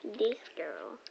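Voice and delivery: whispering it back; breathy echo under the breath. Real, not acted.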